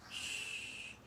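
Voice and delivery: soft drawn-out shush; motherly shushing sound; hushing shush. Acted. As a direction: hush the excited children. Mother hushes the piglets.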